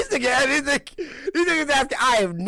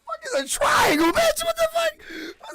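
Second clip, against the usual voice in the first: laughing shout; slower than usual. Real, not acted. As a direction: shriek it out through laughter. Cracking up in disbelief.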